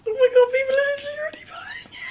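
high, fast breathy squeal; excited disbelief. Real, not acted. Vlogger watching people buy, squeaky excited disbelief. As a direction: rush it out in a squeaky half-whisper. Orders coming in at launch.

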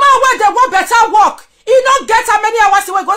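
high, loud, near-crying yell; furious shouting. Real, not acted. Accusing a rival on live video, shouting furiously. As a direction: scream the accusations. Heated rant escalating to shouting.

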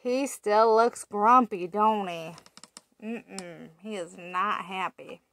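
ordinary delivery, nothing marked

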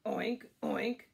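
put-on loud oinks for children to copy; pig oink. Shown as a demonstration. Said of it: "the pig".

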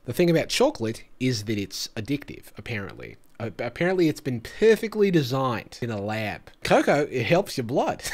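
lightly done Australian accent imitating the TikTok guy. YouTuber parodying the chocolate TikToker, mock Australian accent. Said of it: "I love to do my Australian accent".

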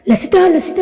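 high-pitched, mildly anguished call; tearful calling. A narrator's reading. Father calling his fallen daughter, understated tearful cry. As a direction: narrate a father's cry without real sobbing. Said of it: "గట్టిగా ఏడుస్తూ పిలుస్తాడు".

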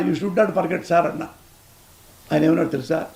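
usual speaking voice

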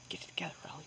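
hushed pep talk to herself; whispering to calm her nerves; whispered self-scolding. Real, not acted. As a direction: whisper it to yourself. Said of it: "self-scolding whisper".

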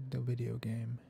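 ordinary talk, nothing marked

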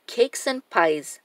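ordinary delivery

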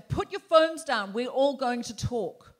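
slow, loud bossy demand; pushy drunk guy. Retold or imitated. Imitating a drunk man on the train, slow and loud. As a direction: lay down the law, slowly. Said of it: "the guy was going".

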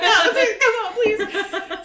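high, loud begging through laughter; laughing plea. Retold or imitated. Scared daughter begging her mom on the phone, high laughing plea. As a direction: plead with mom, cracking up.

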